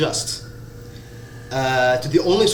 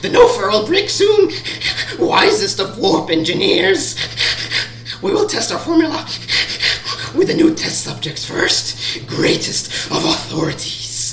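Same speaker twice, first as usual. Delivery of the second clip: much higher, louder, fawning creature voice; squeaky servant creature; 12 semitones higher, 3 dB louder than usual. Acted. Underling reporting to the masked master, high squeaky grovelling.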